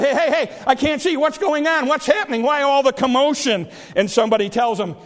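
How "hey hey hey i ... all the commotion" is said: higher-pitched, urgent calling to passers-by; crying out. Retold or imitated. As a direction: blind beggar calling out questions. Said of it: "He cries out".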